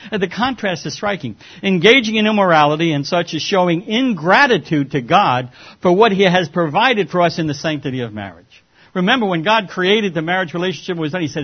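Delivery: loud, high, ringing pulpit voice; preaching at full force. Acted. Preacher warns the church against immorality, full force. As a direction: hammer it home to the congregation. Preacher drives the point home.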